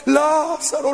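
hammed-up funeral cry of "Lázaro"; mock mourners' wail. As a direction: wail the name like the grieving crowd. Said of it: "toda llore y llore".

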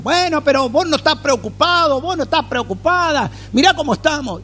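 mock anxious complaint, pitched high; whining worry at a calm neighbour; worried complainer. Preacher imitating a worried believer, high and fretful.

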